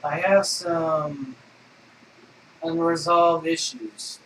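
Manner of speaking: slow, heavy, mock-serious; slow solemn confession. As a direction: confess it gravely and slowly.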